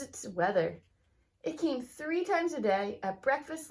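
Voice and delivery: lively, expressive storybook reading; reading to children. Acted. Teacher reading a picture book aloud to her students, lively storybook voice. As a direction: read it like story time for a class.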